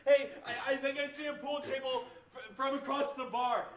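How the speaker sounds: loud bar voice, calling out; shouting across bar. Acted. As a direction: yell it across a noisy bar.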